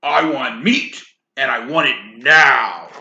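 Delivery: loud shouted demand; roaring. Acted. Giant shouting at his wife for meat. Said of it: "he roared".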